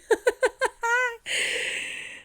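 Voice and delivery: high, long laugh through tears; real high-pitched giggling fit. Real, not acted. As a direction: burst out laughing while teary. Laughs at her own tears.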